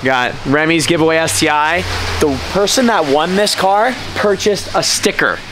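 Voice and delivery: ordinary talk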